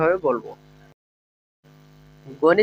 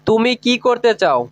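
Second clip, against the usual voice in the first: ordinary delivery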